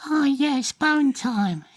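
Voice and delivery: tiny high squeaky fairy voice; very small voice. Acted. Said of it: "a very small voice".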